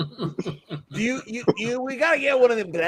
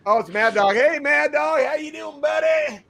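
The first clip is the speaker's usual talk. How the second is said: big goofy Mad Dog greeting; 8 semitones higher than usual. Retold or imitated. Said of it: "I'm like".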